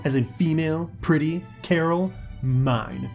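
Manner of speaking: "female", "pretty", "Carol", "mine" each jabbed out separately; word-by-word emphasis. A narrator's reading. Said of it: "each of the last four words was accompanied by a hard poke".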